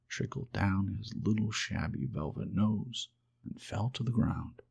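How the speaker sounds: ordinary delivery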